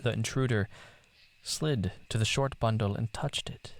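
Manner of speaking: plain reading voice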